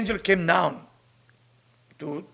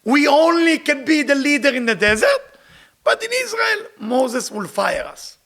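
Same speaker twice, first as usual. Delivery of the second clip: spies' worry pitched higher, louder; fearful spies; 6 semitones higher, 3 dB louder than usual. Retold or imitated. Teacher voicing the spies afraid of Moses, worried and high. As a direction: sound like frightened leaders afraid of losing power. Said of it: "That's what the Meraglim did".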